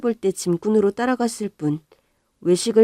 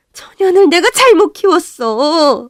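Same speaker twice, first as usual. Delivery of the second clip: angry lament; 10 semitones higher, 10 dB louder, slower than usual. Acted. Mother berates daughter.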